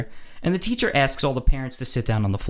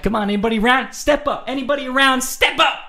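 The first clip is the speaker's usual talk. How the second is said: calling for backup; 12 semitones higher than usual. Acted. Waiting for someone else to confront the drunk guys, calling out. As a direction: holler it up high like a carnival challenge.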